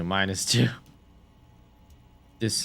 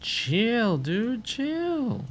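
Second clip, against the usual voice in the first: squeak; much slower than usual. Real, not acted.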